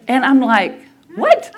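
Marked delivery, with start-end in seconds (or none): 1.2-1.5 shocked what. Retold or imitated. Shocked reaction to mom's yes.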